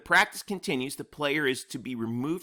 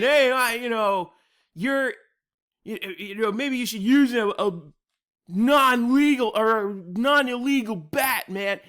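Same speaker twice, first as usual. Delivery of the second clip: mock fan heckle, higher and louder; heckling fan; 6 semitones higher, 4 dB louder than usual. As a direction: play a fan yelling from the stands. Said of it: "start yelling at him".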